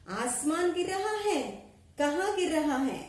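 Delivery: loud, high roaring question from the lion; lion's roar. Acted. Lion roars at the fleeing animals, loud and high. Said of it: "దహాడ్ కర్ కహా".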